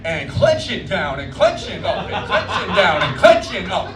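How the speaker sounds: lilting up-and-down exercise cue, laughing; mock fitness instructor. Shown as a demonstration. Comic leading the crowd through a silly workout, sing-song. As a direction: count the reps like a workout coach. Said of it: "Follow along with me".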